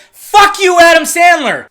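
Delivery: angry outburst. Real, not acted. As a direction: shout it, genuinely fed up. Outburst at Adam Sandler.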